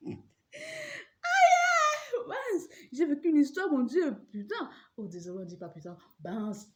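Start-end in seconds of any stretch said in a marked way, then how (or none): 0.1-4.7 high, loud laughing exclamation; laugh-cry at the memory. Real, not acted. Laughing at her own story. (exclamation en riant)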